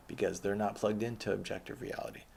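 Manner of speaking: normal talking voice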